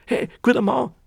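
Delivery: hoch und laut über die Straße gerufen; hinübergerufen. Acted. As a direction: ruf ihn quer über den Platz an. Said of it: "da hat er mir gleich geschrien". (lauter, hoher Zuruf des Kramers)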